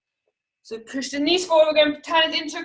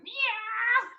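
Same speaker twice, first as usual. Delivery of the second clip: miaow like a cross cat; soft high cat yowl; angry cat; 8 semitones higher, 8 dB quieter than usual. Shown as a demonstration. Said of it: "be a really angry cat".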